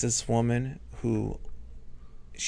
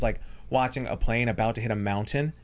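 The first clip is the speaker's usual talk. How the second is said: ordinary talk, nothing marked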